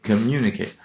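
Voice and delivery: "communicate" with stress on MU; antepenultimate stress. Shown as a demonstration. Lecturer showing the rule, "communicate" stressed on MU. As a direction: lean on the second syllable of "communicate". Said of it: "it will assign stress on the antepenultimate syllable".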